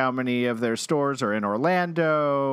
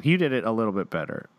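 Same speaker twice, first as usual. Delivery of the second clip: ordinary talk, nothing marked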